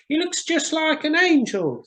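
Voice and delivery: put-on charity children. Charity children leaving the cathedral, high and wondering. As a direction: sing it out like small children in awe. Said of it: "said the charity children".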